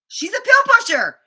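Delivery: shocked realisation. Retold or imitated. Realising inner thought.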